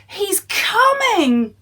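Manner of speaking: high, loud, slow warning; frightened giantess. Acted. Giantess warning Jack the giant approaches, high alarm. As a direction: warn him in panic. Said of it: "cried the giantess".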